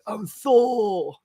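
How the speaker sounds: hammed-up Australian superhero announcement; Chris Hemsworth. Shown as a demonstration. Streamer playing the actor's superhero role, mock-shouted Australian accent. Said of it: "Chris Hemsworth is gonna come out like this".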